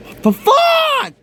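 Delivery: real frustrated yell; exasperated shout. Real, not acted. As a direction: blurt it out in disbelief. Frustrated outburst at laptop.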